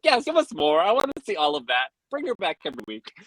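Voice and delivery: high-pitched, eager gushing; giddy quick demand; excited fan. Retold or imitated. Quotes own delighted fan reaction.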